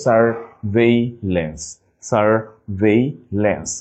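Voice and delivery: slow "surveillance" as sur, vei, lance; syllable by syllable. Shown as a demonstration. Teacher showing spelling, "surveillance" slowly split. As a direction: pause between syllables of "surveillance". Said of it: "just একটু ভেঙে নিব".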